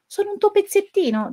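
high-pitched questioning voice; character voice. Acted. The strong one asks Pezzettino, high character voice. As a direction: pitch it up like a small figure asking. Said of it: "domandò quello forte".

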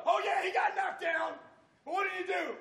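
ordinary delivery

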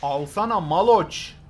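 loud, slow excited shout; excited yell. Real, not acted. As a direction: yell it at the screen. Excited gamer yelling at game. (heyecanlı bağırış)

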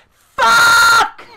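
high, very loud drawn-out yell; frustrated shout. Real, not acted. Player failing at the game cuts off their own excuse, frustrated shout. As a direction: burst out in real frustration.

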